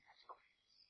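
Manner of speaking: breathy whisper of awe. Real, not acted. Preacher whispering the word grace to the congregation. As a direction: whisper it like a secret.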